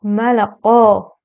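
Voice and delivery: ملقا drawn out very slowly; slow teaching demo of ملقا. Shown as a demonstration. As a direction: stretch ملقا slowly, syllable by syllable. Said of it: "در این قسمت".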